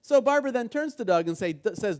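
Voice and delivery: ordinary talk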